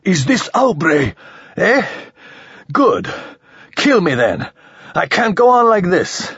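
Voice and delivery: at the end of his rope, demanding death; desperate outburst. A narrator's reading. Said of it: "exhausted by his outburst".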